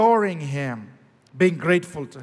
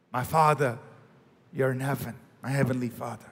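intimate prayer; 6 semitones lower, 6 dB quieter, faster than usual. Shown as a demonstration. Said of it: "Come to God on the basis of relationship".

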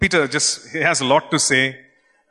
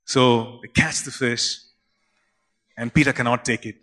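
ordinary delivery

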